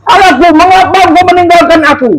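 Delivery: high anguished outcry; crying out. Acted. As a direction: cry it out to heaven. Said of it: "sudah berteriak".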